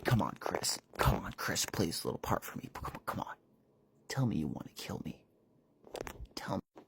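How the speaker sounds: low breathy whisper of a tormentor; whispered villain goading. Acted. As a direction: coax him in a creepy hush.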